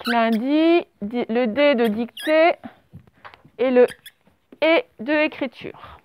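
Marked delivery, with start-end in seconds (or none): 0.1-4.8 slow dictation. Shown as a demonstration. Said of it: "Alors, lundi".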